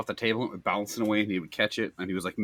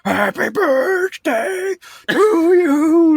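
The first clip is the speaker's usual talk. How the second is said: drawn-out high, loud birthday yell; mock screaming; 6 dB louder, much slower than usual. Chef screaming over the flames at the grill, mock screaming. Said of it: "he's just screaming".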